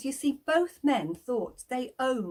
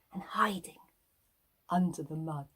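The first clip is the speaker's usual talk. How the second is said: a secretive little whisper; storyteller whisper; 4 semitones lower, 3 dB quieter than usual. A narrator's reading. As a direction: whisper it, hiding in the mud.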